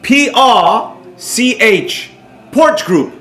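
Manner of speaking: ordinary talk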